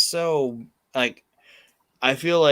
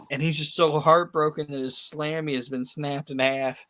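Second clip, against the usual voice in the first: ordinary delivery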